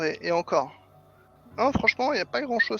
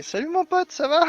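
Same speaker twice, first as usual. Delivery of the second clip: much higher, friendly buddy voice; cheery high greeting; 10 semitones higher than usual. Retold or imitated. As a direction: greet him like an old pal, way up high. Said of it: "Gabriel, il va lui parler".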